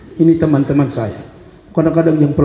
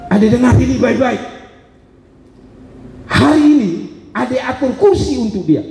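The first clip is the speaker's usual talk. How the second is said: firm mentor; 8 semitones higher than usual. Retold or imitated. Mentor's firm advice, voiced in story.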